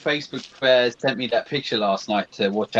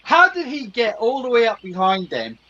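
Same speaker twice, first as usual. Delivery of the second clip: baffled protest, pitched way up; incredulous outburst; 7 semitones higher than usual. Real, not acted. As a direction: demand to know how that's possible. Incredulous at the film's plot.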